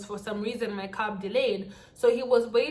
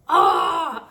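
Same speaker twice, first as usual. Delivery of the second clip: loud, high "ah" of panic; frightened cry; 5 semitones higher, 8 dB louder than usual. Retold or imitated. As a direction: cry out as if scared. Acts out her fear.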